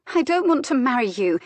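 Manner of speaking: conclusive, irritable. A narrator's reading. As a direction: shut the matter down, annoyed. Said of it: "she explained conclusively, then irritably".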